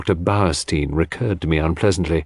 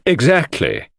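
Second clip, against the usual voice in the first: abrupt; 7 semitones higher, slower than usual. A narrator's reading. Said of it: "with rather unnecessary abruptness".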